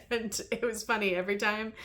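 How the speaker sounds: ordinary talk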